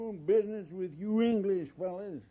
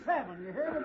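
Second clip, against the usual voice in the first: ordinary talk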